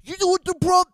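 high, loud goofy gangster; cartoonish mob voice. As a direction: ham it up like a sketch mobster. Imitating a sketch character.

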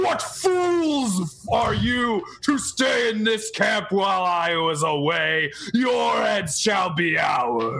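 extremely deep bass voice. Acted. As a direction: roar it out slow, like a looming giant. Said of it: "boom with the deepest voice I can in that old, ancient undercommon".

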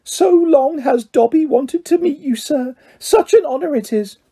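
high, reverent little house elf; Dobby, high-pitched. A narrator's reading. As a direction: gush in a high creature voice. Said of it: "in a high-pitched voice".

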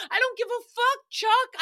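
the rhyme cracking up as she says it; high giggly mock yell; laughing through it. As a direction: try to yell it but break into giggles. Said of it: "I could not say that with a straight face".

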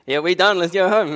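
loud, high, laughing cheer; excited laughing. Retold or imitated. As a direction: crow it through laughter. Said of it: "I actually said that to the boys".

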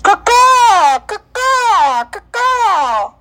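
loud high crow caws; chirping bird person. Pretend. Cheering for the Battlehawks.

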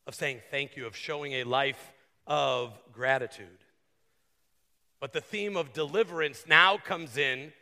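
usual speaking voice

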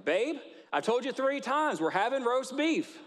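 hammed-up wife who has already answered three times; exasperated wife. Wife snapping back at her husband after three answers.

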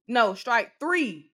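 slow, weighty "strike three"; emphatic correction. Acted. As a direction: drop the count like a verdict. Counting the stylist's strikes.

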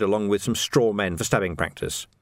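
usual speaking voice

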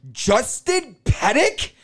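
high, slow, sing-song call of a name. Retold or imitated. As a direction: call out "Justin Pettick?" high, drawn out and rising. Ex calls his name at counter.

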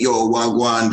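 imitating a put-on Jamaican greeting; light mock Jamaican patois; fake Jamaican accent. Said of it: "fake Jamaican accents".

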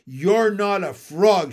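put-on indignant young frog shout. A narrator's reading. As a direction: shout him down, word by word. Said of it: "shouted Jimmy".

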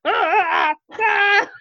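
high, loud panicked scream; scared shriek. Pretend. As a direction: shriek at the spider. Acting ghost friend seeing spider.

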